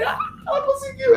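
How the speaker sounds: high laughing cheer; laughing joy. Retold or imitated. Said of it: "Eu falei".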